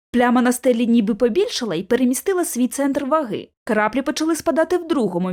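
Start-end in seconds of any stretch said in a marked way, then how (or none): none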